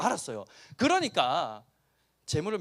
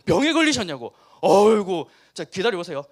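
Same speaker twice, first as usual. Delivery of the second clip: mock fawning host; 9 dB louder than usual. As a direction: play the overeager healer welcoming a guest. Preacher acts out prophet greeting. (과장된 아첨하는 말투)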